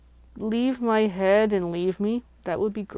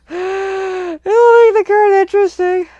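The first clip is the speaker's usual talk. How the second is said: laughing; 12 semitones higher, 10 dB louder, slower than usual. Real, not acted. Laughs while picturing a funny card.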